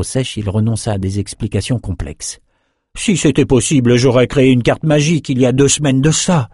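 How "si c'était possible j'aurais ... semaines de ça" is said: Rennes replying. Acted. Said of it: "répondit Rennes".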